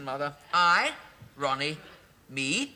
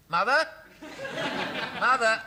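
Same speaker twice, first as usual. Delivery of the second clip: loud, high call down the line; 9 semitones higher, 4 dB louder than usual. Acted. Son calling his weeping mother on the phone, loud high call. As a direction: shout for her to answer.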